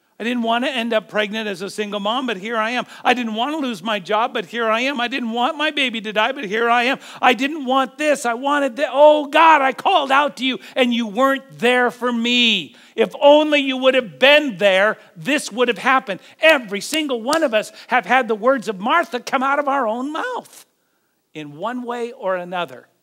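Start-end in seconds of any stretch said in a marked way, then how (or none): 0.1-16.2 building from pained complaint to loud, high plea; anguished outcry. Acted. Preacher voices hurting believers crying out to God, anguished outcry. 16.4-20.7 loud, high-pitched sermon delivery; forceful preaching. Real, not acted. Preacher telling us we all echo Martha, forceful preaching.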